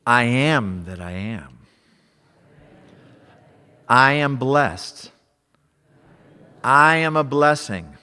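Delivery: loud, slow, high preaching. Acted. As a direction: proclaim each affirmation. Preaching affirmations at full force.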